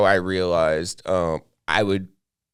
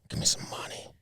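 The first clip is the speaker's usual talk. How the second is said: creepy whisper; 12 dB quieter than usual. Pretend. Pretend homeless creep begging.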